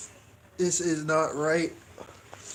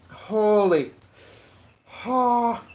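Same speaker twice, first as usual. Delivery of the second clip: ordinary delivery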